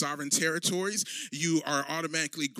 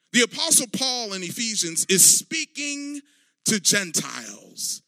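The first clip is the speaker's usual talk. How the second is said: rising, louder sermon build; fervent preaching; 6 semitones higher, 3 dB louder than usual. Acted. Pastor rouses the congregation about Christ, fervent preaching. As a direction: drive the message home to the room.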